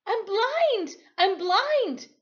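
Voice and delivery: high, loud panicked cry; terrified scream. Acted. Blinded boy screams to Timothy, high and loud. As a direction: scream it in sheer terror. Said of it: "I screamed to Timothy".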